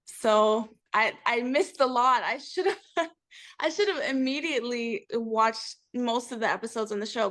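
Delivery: normal talking voice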